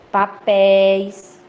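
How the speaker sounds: "papéis" drawn out on open éi; slow teacher's "papéis" with long open é; open diphthong. Shown as a demonstration. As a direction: stretch the last syllable of "papéis", vowel wide open. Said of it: "eles são ditongos abertos".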